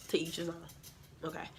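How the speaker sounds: whispered shady aside; whispered side comment. Real, not acted. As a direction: drop to a breathy whisper, just between us. Shady aside about nails.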